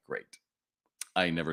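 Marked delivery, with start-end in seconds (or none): 0.1-0.4 low, much softer, flat dead "Great"; deflated sarcasm. Retold or imitated. The star walks out, clerk's low soft deflated "Great". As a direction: let the word sink in defeat.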